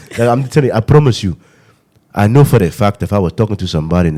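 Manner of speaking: put-on African accent, animated and insistent. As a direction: plead your case in a mock African accent. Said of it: "If you just talk like that".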